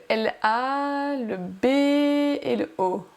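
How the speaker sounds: slow, stretched letter by letter; long held vowels on "A" and "B"; drawn-out letters. Pretend. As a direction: draw out each letter as if hunting for it. Teasing, drawn-out search for the letters.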